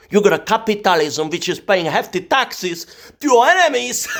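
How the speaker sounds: gloating. Acted. Mocking Americans, a loud gloating jab. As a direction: gloat at them.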